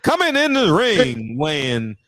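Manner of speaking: laughing boxing-announcer parody; mock ring announcer. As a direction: announce the weigh-in with mock pomp.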